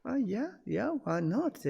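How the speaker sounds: easy acceptance. Shown as a demonstration. Skillful helper to family or friends, accepting first, easy agreement. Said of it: "first time whatever they say".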